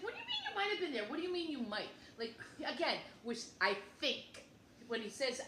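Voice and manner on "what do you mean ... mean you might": high, fast and disbelieving; pitched-up, rushed what-do-you-mean; incredulous outburst. Retold or imitated. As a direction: fire it back at him, stunned. Her incredulous retort to her husband.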